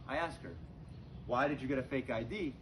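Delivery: ordinary talk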